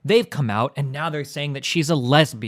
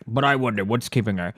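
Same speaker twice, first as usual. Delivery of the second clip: low put-on character voice; 6 semitones lower, 5 dB quieter than usual. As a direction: drop it low for a comic character. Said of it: "I don't know whose voice this was".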